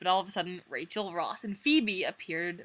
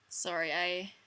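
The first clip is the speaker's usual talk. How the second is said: ordinary delivery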